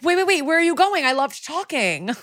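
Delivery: high, breathless pleading, slowing on the last words; suddenly desperate to keep him talking; mock panicked plea. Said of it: "suddenly she's like".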